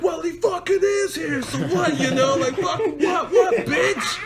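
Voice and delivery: very high shouted goading; taunting comeback. Retold or imitated. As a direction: goad him in a high, excited mock shout. Acts out taunting the driver back.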